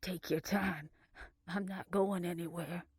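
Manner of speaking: wry, faintly amused undertone; a touch of breathy amusement; breathed laugh. A narrator's reading. Blair joking from jail to her sister, breath of laughter. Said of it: "Blair breathed a laugh".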